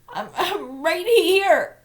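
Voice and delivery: whimpering. A narrator's reading. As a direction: plead with a broken voice. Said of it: "he whimpered".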